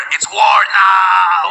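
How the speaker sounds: drawn-out, overblown mock alarm; mock war declaration. Streamer mimicking her overreaction to the stream, drawn-out mock alarm. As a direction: stretch it out like a melodramatic battle cry.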